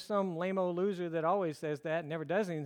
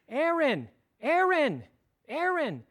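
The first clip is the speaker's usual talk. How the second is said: raised, higher mock call to a crying baby; child calling out; 9 semitones higher, 8 dB louder, slower than usual. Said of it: "as she starts to get agitated and cry".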